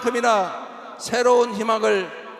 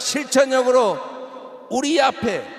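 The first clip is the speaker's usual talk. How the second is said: ordinary delivery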